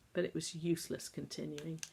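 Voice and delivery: usual speaking voice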